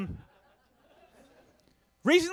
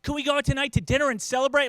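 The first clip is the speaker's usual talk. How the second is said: put-on higher-pitched voice of an excited kid; eager little daughter; 6 semitones higher, 6 dB louder than usual. Daughter asks dad for celebration dinner, lightly voiced eager kid. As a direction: lightly voice a hopeful little girl. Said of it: "She's like".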